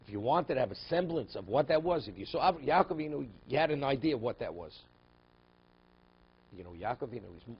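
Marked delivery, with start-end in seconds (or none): 0.1-4.7 loud, raised-pitch insistent teaching; emphatic preaching. Real, not acted. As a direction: press the point home at full force.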